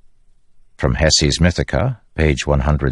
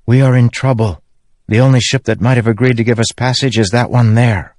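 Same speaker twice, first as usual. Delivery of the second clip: higher-pitched, mildly worried character voice; worried companion; 6 semitones higher than usual. A narrator's reading. Companion tells the group their passage is leaving, mildly worried. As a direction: report the trouble in a lightly raised voice.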